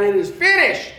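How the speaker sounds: "finished" called out loud and falling; shout of triumph. Acted. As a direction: cry "It is finished" in victory. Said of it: "It says he cried out".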